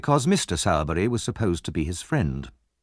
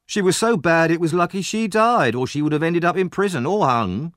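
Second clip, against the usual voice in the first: high, emphatic bully's jeer; scornful taunt, pitched well up; goading insult; 8 semitones higher, 3 dB louder than usual. Acted. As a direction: pile on the insults. Bully insulting dead mother.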